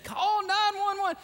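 high-pitched frantic call for help; acted panic, voice shooting way up; panicking. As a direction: mock-desperate emergency cry. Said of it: "I was panicking".

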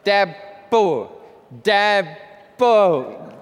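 lightly voiced baby talk; a hint of a little brother's lisp; toddler mispronouncing. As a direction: mimic a toddler saying Dabo. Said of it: "he would try to say".

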